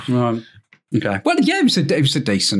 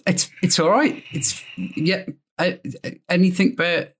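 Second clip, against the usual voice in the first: ordinary delivery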